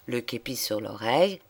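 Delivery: usual speaking voice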